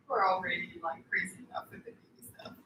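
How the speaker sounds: hushed whisper. Real, not acted. Whispering aside about baby.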